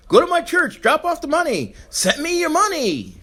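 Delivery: high, loud preacher parody; mock preacher. Mocking televangelists to his viewers, high preacher voice. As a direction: squeal it like a greedy televangelist.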